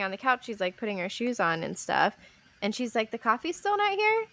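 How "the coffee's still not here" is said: puzzled, pitched way up; mom's confused high question. Retold or imitated. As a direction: ask it baffled, voice shooting high. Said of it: "she's just looking at me confused".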